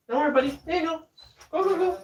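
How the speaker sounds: high-pitched encouragement to a little buddy; pet-talk voice. Real, not acted. Soothing a pet off to side.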